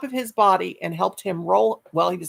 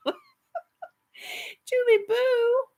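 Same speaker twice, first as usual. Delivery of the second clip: high drawn-out coo; much slower than usual. Real, not acted. Drawn-out crying call of name.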